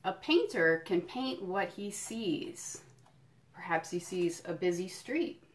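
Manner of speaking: slow, lilting picture-book read; reading to children. Acted. Museum educator reading a picture book to kids, storytelling. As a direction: savour each item for little listeners.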